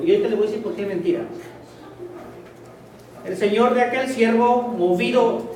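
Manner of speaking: ordinary talk, nothing marked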